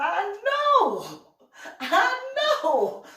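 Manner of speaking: high and loud, laughing through it; laughing agreement. Retold or imitated. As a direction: laugh it out, yes I know.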